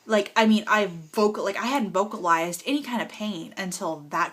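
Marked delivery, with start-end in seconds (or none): none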